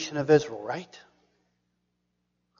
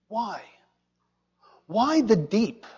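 ordinary talk

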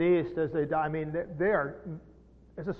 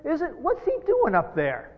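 higher and louder, offended hometown crowd; scandalized townspeople; 5 semitones higher, 8 dB louder than usual. Acted. Hometown folk scoffing at Jesus in the synagogue, scandalized. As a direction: scoff like neighbours who knew him as a kid. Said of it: "They were scandalized by this".

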